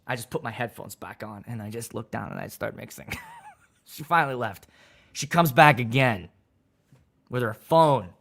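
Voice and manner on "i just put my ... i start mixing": withdrawn mutter. Retold or imitated. Ignoring her, headphones on.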